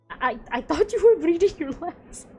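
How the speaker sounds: put-on stuttering in surprise at the old man; startled stammer. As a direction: blurt it out in a fright.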